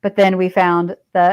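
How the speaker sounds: ordinary talk, nothing marked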